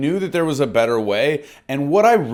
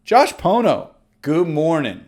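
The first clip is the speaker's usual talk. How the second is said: warm confident greeting; 3 dB louder than usual. Shown as a demonstration. Agent pretending to call John, warm and confident. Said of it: "practice this line over and again".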